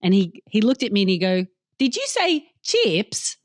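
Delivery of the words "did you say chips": put-on high, slow child querying an Australian "chips"; child's puzzled take on an Australian accent; mimicking Australian accent. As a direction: slowly echo Mum's Australian "chips", pitched high. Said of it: "That's my Australian accent".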